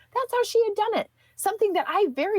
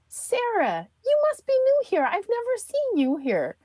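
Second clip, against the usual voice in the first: bright greeting; 10 semitones higher than usual. Retold or imitated. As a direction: sing out the greeting like a friendly regular. Sister greeting cashier brightly.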